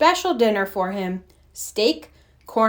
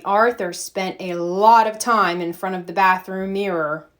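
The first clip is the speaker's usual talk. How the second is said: usual speaking voice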